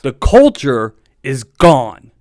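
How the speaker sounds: slow and loud, leaning on culture; drawn-out loud declaration of loss; emphatic declaration. Real, not acted. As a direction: hammer it home, word by word. Opening rant about the team.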